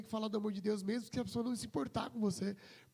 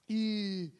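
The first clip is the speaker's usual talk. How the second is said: normal talking voice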